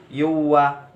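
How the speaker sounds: "Yuwa" merged into a held, humming w; idgham bi ghunnah. Shown as a demonstration. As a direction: hold the nasal glide in "Yuwa". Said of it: "bacaan idgham bi gunah". (baca "Yuwa" dengan dengung yang ditahan)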